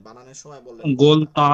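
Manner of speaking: গোলতা said loudly with a clearly rounded ta; teacher demoing গোলতা loudly; clear gol ta. Shown as a demonstration. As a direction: sound out গোলতা so every letter rings. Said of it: "এটাকে একটু clear শুনাইলেন".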